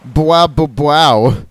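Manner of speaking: loud mock growl barks "bwa bwa bwow". Gamer voicing growling Houndooms with loud mock barks. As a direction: bark out the growls for fun.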